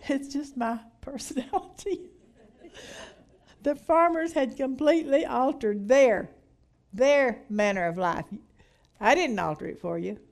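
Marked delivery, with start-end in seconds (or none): 3.6-8.3 giggling at the start, still trying to read; fighting off the laughter as she reads; laughing through the line. Real, not acted. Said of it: "I'm sorry".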